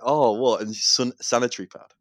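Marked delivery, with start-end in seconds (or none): 0.0-0.6 loud startled Oh, what; a penny-dropping exclamation, much louder; sudden shocked realisation, louder; sudden realization. Retold or imitated. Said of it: "then it hits me".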